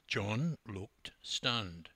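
plain reading voice

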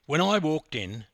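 plain reading voice

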